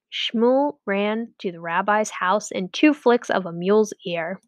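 plain reading voice

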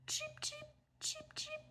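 tiny high chick peeps; baby chick cheeps. Acted. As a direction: squeak like a newborn duckling. Newly hatched ducklings cheep.

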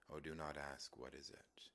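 ordinary talk

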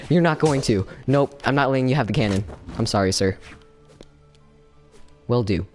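ordinary talk, nothing marked

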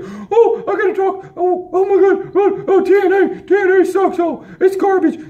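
very high, silly whining impression; mock his complaints in a squeaky high voice; mocking whiner. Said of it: "You're just out there".

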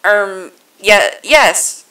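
loud, halting hesitation; flustered stammer. A narrator's reading. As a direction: stumble into a yes. Flustered Mister Zero answers haltingly.

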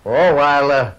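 ordinary talk

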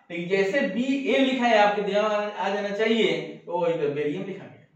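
ordinary talk, nothing marked

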